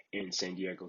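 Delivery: ordinary talk, nothing marked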